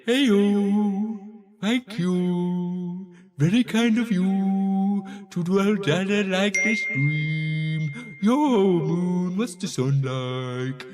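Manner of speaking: slow, drawn-out moon character; talking moon widget. Acted. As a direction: voice a slow, friendly cartoon moon. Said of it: "it will show up and say".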